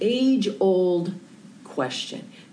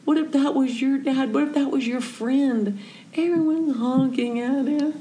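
higher, pleading and distressed; pleading upset; 7 semitones higher than usual. Retold or imitated. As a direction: beg him to feel for the old man. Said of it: "I'm really upset".

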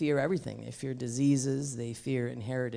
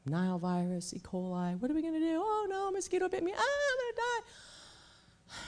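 rising higher and higher into hammed-up panic; mock panic; 14 semitones higher than usual. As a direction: play a frightened reader losing it over a mosquito bite. Mocking fearful social media readers.

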